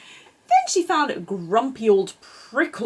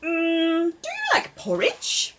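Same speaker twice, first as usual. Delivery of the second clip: coaxing high voice; 7 semitones higher, slower than usual. Acted. Rabbit coaxing the duckling.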